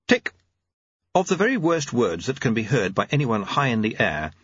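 ordinary delivery, nothing marked

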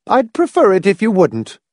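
high, tight objection; said tightly. A narrator's reading. Mother warning Hamilton off her son, tight disapproval. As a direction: hold back the anger. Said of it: "Missus Pritchett said tightly".

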